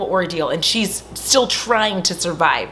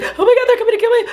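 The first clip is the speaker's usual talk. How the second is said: high, loud panicked cry; mock terror; 5 dB louder, much faster than usual. Sally telling the man she's being chased, hammed-up panic. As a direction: shriek it like a hunted girl.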